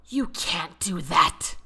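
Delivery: forced through, trying to stay controlled; seething. A narrator's reading. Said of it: "Robert seethed".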